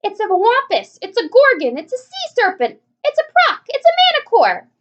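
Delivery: very high, loud frantic yells; panicked shouting. A narrator's reading. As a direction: scream out each monster name. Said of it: "all started shouting".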